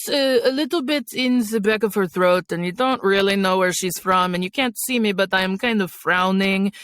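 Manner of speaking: throaty Eastern European character accent; frowning Eastern European woman, back-of-the-throat voice. Shown as a demonstration. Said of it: "one that I like doing is an Eastern European accent".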